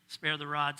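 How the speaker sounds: ordinary delivery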